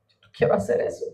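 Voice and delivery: whispered, grudging no; the believer backs out of God's request in a whisper; reluctant refusal. Retold or imitated. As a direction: mutter the refusal like a sulky child. Believer refuses God, mock reluctance.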